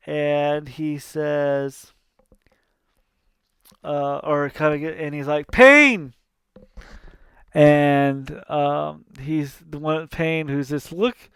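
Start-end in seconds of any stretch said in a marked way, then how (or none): 5.5-6.1 high, loud bark of a name; calling shout. Retold or imitated. Hades summoning his minion Pain, a loud high call. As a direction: yell for the minion.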